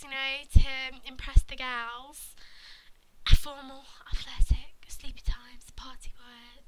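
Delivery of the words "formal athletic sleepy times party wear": soft unvoiced whisper. Listing the boy's outfits, whispered. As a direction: rattle off the list under your breath.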